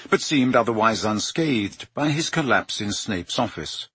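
ordinary delivery

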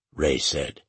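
ordinary delivery, nothing marked

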